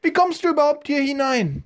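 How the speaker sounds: put-on very high, scolding old lady voice; an old woman catching an intruder in her garden. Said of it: "rief eine Frauenstimme hinter ihm".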